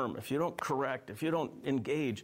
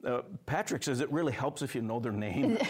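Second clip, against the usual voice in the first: ordinary talk, nothing marked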